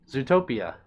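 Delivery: ordinary talk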